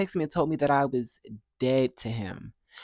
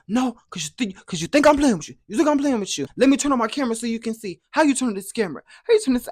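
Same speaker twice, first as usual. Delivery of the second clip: higher and louder, furious ranting; irate uncle; 10 semitones higher, 7 dB louder, faster than usual. Retold or imitated. Uncle calls at dawn, irate yelling about grandma. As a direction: tear into her like a man foaming at the mouth. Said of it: "he picks up the phone irate".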